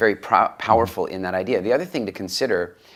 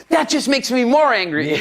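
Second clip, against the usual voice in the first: mock son's retort, much higher and louder; 5 dB louder than usual. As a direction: snap back like an exasperated kid, hammed up. Son's retort in retold argument.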